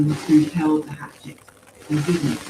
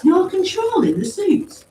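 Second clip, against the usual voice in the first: high, slow, louder question; incredulous demand; 7 dB louder than usual. A narrator's reading. Paz questions who works the suits, incredulous demand. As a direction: draw out the disbelief and rise at the end. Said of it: "Paz demanded".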